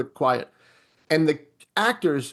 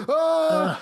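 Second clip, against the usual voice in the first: high, freaked-out yelp; a startled guard's cry, imitated; mock scream; 11 semitones higher than usual. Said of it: "And he freaks out".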